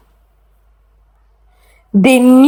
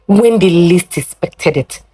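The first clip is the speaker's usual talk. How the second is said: usual speaking voice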